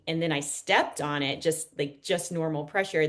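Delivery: usual speaking voice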